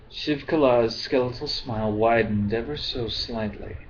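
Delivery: ordinary delivery, nothing marked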